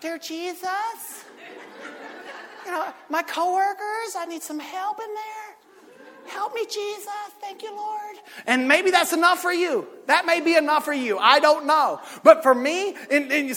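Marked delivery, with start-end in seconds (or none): 0.0-8.2 hushed, high little prayer voice; soft prayer. Retold or imitated. The polite churchgoer praying softly to Jesus, hushed. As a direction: pray it quietly, almost under your breath. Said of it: "you don't have to get so loud when you talk to God".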